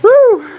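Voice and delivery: loud high winded whoop; after a workout. Real, not acted. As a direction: blow out a big breath. Exhale after exertion.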